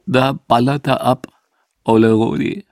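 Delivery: a mild tearful quiver, lightly imitated; half-hearted imitation of a grieving old bass player; choked with tears. Grieving Max at the crematorium, mild tearful imitation. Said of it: "mit tränenerstickter Stimme".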